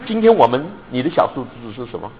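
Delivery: ordinary talk, nothing marked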